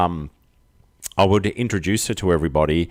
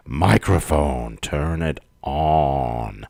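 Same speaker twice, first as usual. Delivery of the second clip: slow lower deep command; Marika voice; 5 semitones lower, much slower than usual. Pretend. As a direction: drawl it out slowly. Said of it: "That was Marika".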